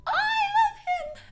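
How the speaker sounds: very high, drawn-out squeal; long high-pitched wail of adoration; wild cry. Real, not acted. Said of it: "that was the cry of the wild".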